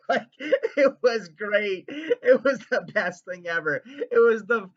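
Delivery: loud, laughing rush of delight; giddy and loud; real excitement. Real, not acted. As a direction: gush with laughter. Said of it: "I'm so excited right now".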